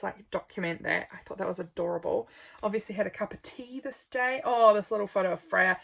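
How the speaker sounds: ordinary talk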